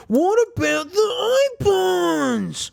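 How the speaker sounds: high, slow mock cry from the fans; hammed-up fan outcry, drawn out; mock viewers screaming. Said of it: "I can hear you guys screaming".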